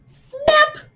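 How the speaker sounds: high loud snap; sharp loud crocodile snap. Shown as a demonstration. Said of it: "Make it nice and loud".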